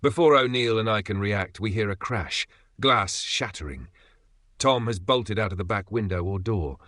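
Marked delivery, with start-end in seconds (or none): none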